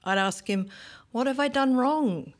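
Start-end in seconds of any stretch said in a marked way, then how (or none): 1.1-2.3 higher-pitched, hurt bewilderment; bewildered pleading. Acted. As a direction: plead as if lost for an answer. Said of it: "He loved how bewildered I was".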